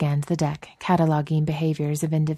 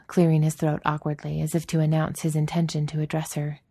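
usual speaking voice

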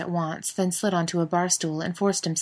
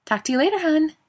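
higher, louder sweet farewell; woman's voice; 11 semitones higher, 5 dB louder than usual. A narrator's reading. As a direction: sing it out sweetly. Daniil voiced on phone.

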